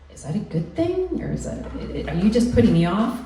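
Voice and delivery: mock startled young model; anxious surprise. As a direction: ask it nervously, pitch leaping. Nervous retold self-questioning.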